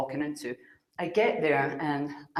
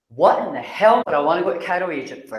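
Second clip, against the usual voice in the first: louder, punchy disbelief; What in the hell, thrown out loud; incredulous outburst; 2 semitones higher, 9 dB louder than usual. Retold or imitated. Arguing with Spirit about going to Cairo, loud disbelief.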